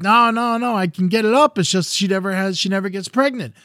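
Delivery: high, hurried-to-deny retold protest; defensive denial. Husband answers the elder's question, defensive. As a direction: protest it away, pitch up. Husband denies the impotence suggestion.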